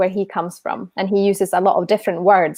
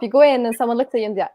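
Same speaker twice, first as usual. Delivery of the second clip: ordinary talk, nothing marked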